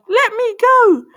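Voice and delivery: high, loud shout to be released. A narrator's reading. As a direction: shout it out, pitch right up. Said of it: "As Cindy shouted".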